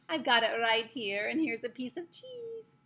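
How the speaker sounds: loud, slow yell rising high at the end; Grand High Witch. A narrator's reading. Witch baiting the mouse with cheese, yelling. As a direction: yell it, then lilt up high and teasing. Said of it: "I heard the Grand High Witch yelling".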